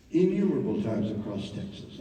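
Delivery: ordinary delivery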